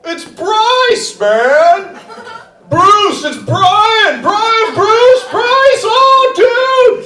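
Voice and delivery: shouting college dude; party bro. Retold or imitated. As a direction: yell over the party noise. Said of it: "when I'm at a party".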